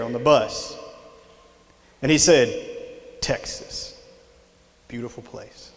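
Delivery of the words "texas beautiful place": hushed, savoring imitation of a man's reverie; softly retold wistful remark; quiet musing. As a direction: say it very softly, with long pauses. Retold man sitting back, musing.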